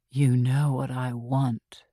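lightly voiced killer, slow and low; seductive killer. A narrator's reading. Gretchen teasing Archie on the phone, slow and low. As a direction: purr it slowly, a hint of menace.

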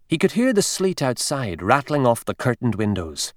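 usual speaking voice

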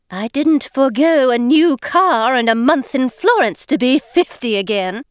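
high, overpronounced fake British voice with dropped Rs; a high, girlish put-on British act, words overpronounced; faux-British Eliza. Scene: Eliza cheerfully greets newcomer Jane, high fake-British girlish voice. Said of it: "she was attempting to sound British".

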